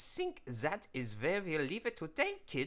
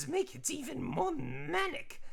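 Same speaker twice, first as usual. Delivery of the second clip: manic cartoon; 14 semitones higher than usual. Voicing the manic drawing.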